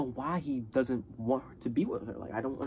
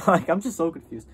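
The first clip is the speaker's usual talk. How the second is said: higher and louder, laughing through the words; laughing confusion; 7 semitones higher, 6 dB louder than usual. Real, not acted. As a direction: laugh at how confused you are. Laughing at her own confusion.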